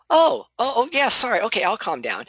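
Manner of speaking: high, put-on apologetic yielding; mock sheepish backing down. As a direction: play the one who was told to calm down, caving sarcastically. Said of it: "They're like".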